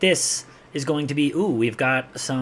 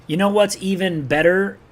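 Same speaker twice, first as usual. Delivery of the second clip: ordinary talk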